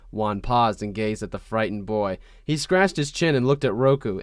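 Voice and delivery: ordinary delivery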